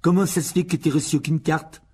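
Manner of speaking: loud schoolyard sneer; taunting bully. A narrator's reading. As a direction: jeer at him like a spoilt kid. Dudley taunting Harry.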